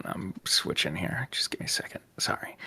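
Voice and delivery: quick whispered aside off mic. Real, not acted. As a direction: whisper it under your breath. Aside while fixing the laptop.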